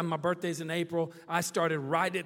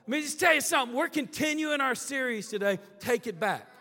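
louder, higher pulpit delivery; preaching; 6 semitones higher, 7 dB louder than usual. Real, not acted. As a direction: drive the series theme home to the church. Turns to sermon theme.

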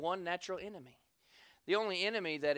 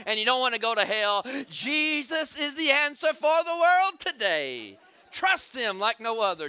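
preaching; 4 semitones higher, 6 dB louder than usual. Acted. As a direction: drive it home to the congregation. Preaching about Jesus to congregation.

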